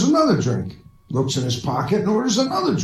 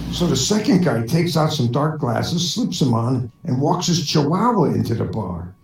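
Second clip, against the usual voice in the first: ordinary talk, nothing marked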